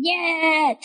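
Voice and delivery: sudden high mock shriek at the heavens; mock scream. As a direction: imitate a tyrant's furious yell, hammed-up.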